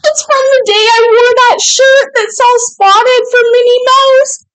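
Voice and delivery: very high, real excited squeal; overcome with excitement. Real, not acted. Recognising the gift from the day of that shirt, overcome. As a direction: squeal it out, overwhelmed by the surprise.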